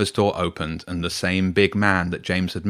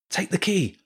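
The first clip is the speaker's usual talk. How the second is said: urgent plea; 11 semitones higher than usual. Acted. Urgent plea while escaping.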